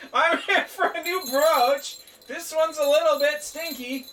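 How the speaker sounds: high, slow, loud goofy character; high silly voice. Pretend. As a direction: ham it up in a squeaky silly voice. Character speech at cave entrance.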